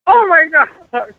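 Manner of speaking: high, loud wail of dismay; hammed-up shocked dad crying out; mock horror. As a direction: throw the voice way up, aghast. Retold shock at daughter's news.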